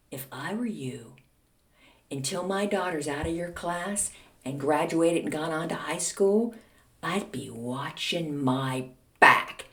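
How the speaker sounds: low, soft, slowing mock menace; quiet warning. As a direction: imitate mom's threat, dragging out the last words. Mother's final retold warning.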